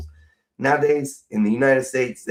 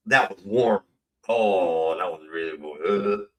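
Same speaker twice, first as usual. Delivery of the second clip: disgusted; 7 semitones lower, 4 dB quieter, slower than usual. Real, not acted. Grossed out by warm beer.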